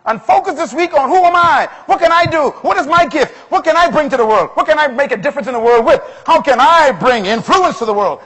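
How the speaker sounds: loud, high, urgent rhetorical questions; impassioned preacher at a peak; full-force preaching. Real, not acted. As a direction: fire the questions at the congregation.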